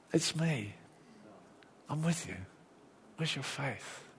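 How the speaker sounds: soft, close, tender; quietly calm a frightened friend; Jesus gently reassuring his scared disciples, softly; gentle reassurance. Retold or imitated.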